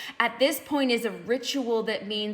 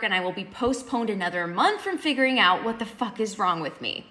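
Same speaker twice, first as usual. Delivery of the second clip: normal talking voice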